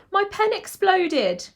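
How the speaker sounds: high, loud, slow schoolboy complaint; piping small boy. A narrator's reading. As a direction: pipe up like a little boy reporting a disaster. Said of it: "Owen piped up".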